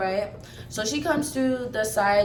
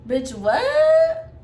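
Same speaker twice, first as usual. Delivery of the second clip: sudden much higher, louder "what?"; mock disbelief; 5 dB louder than usual. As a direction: shoot up in incredulous mock shock. Mocking her outburst.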